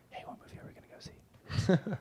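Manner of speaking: whispered echo of his own question; under his breath. Retold or imitated. Host mimicking Jacob's old habit, whispered under the breath. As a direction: repeat it to yourself in a whisper. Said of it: "under his breath".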